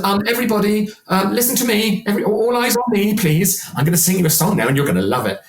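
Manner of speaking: high, eager attention-seeking plea, rushing at the end; a show-off begging for everyone's eyes; show-off inner voice. Retold or imitated. Singer's show-off brain demanding the crowd's attention, high and eager. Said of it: "brain that goes".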